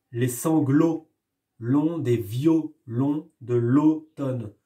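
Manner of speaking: sanglots longs violons with the O drawn out; stressing the O sound. Shown as a demonstration. Teacher making pupils hear the repeated O, slow. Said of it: "le poète répète la syllabe O".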